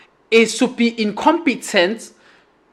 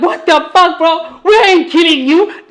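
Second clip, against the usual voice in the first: higher, half-laughing disbelief; a mock-baffled higher protest to a friend; incredulous retelling; 6 semitones higher than usual.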